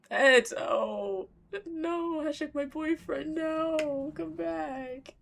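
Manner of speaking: high, drawn-out playful wailing; high-pitched pleading whine; mock-distraught wail. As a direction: cry out after a lost sweetheart, hammed up. Reacting as boyfriend is lost.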